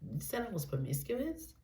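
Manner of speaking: mock-shocked hushed question; shocked disbelief. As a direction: act out a stunned, disbelieving client. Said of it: "You're shocked to hear this".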